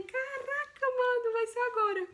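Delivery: sudden panic that it is happening; startled realization. Retold or imitated. Realizing labour starts now. (susto agudo de surpresa)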